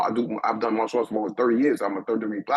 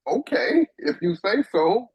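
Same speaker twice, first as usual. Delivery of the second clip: bewildered agreement; 10 semitones higher, 3 dB louder, slower than usual. Retold or imitated. Retold shy agreement to girl.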